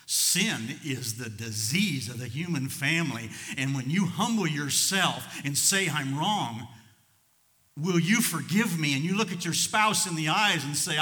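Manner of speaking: loud, slow, impassioned preaching; preaching at full force. Acted. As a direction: pound it out to the congregation, slowly and loud.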